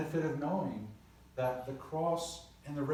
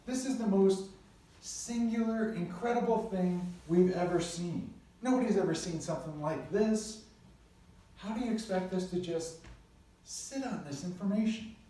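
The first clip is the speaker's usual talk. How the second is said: excited disciples; 6 semitones higher than usual. Retold or imitated.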